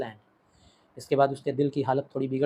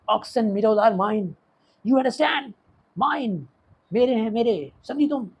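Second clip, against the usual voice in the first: insistent outburst; 7 semitones higher, 4 dB louder, much slower than usual. Acted. Lomov clutches chest, insists angrily.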